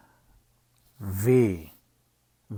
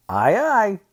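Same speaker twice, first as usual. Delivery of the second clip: lightly acted reply, higher and louder; a raised, louder quoted answer; 10 semitones higher, 5 dB louder than usual. Reader quoting a book character, lightly acted reply. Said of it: "and he said".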